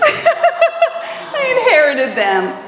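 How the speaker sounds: real laugh running into the words. Real, not acted. Speaker joking with the congregation about borrowed glasses, laughing. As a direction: say it through a drawn-out, high laugh.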